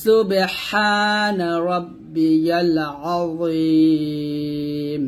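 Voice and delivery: very slow সুবহানা রব্বিয়াল আযীম, আযীম held three beats; slow recitation with a long stretch. Shown as a demonstration. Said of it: "তিন আলিফ টান দিয়ে".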